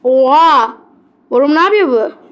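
loud stunned gasp-word; shocked exclamation. Real, not acted. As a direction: burst out in disbelief. Shocked at game event. (şaşkın haykırış)

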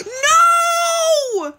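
startled high shriek; real scream. Real, not acted. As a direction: gasp then a high, loud scream. Jumpscare in horror game.

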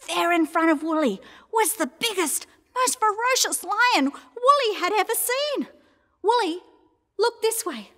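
high-pitched, dramatic alarm; alarmed storytelling. A narrator's reading. Narrator builds fright at lion.

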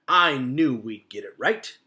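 slow, booming, pleased beaver voice; satisfied beaver. A narrator's reading. Mister Beaver pleased with the new foot, slow and booming. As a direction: savour the success.

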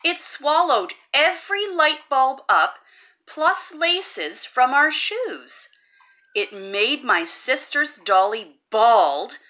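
plain reading voice

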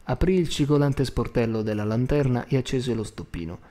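plain reading voice

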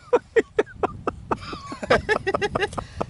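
long high giggling laughter. Real, not acted. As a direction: laugh at a friend's fish tale. Fish grows in the story.

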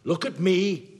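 loud, high command; furious shout demanding he look; angry shout. Acted. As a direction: bellow it at him. Father demands son's attention.

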